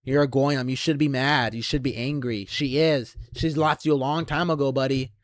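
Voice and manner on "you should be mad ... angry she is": ordinary talk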